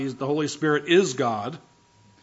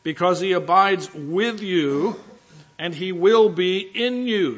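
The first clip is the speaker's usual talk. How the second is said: slow, solemn reading of scripture; 3 semitones higher, 3 dB louder, slower than usual. Acted. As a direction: pastor reads the verse to congregation, slow and solemn. Scripture read aloud slowly.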